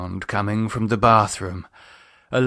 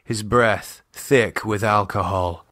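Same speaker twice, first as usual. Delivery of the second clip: ordinary delivery, nothing marked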